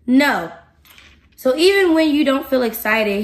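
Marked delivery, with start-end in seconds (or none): 0.1-0.5 firm, fairly loud no without shouting. Acted. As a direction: settle into a firm refusal. Repeats refusal, more contained.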